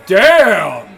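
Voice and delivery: explosive loud curse; loud exclamation. Acted. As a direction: blurt it out loud in shock. Reacting to side effects.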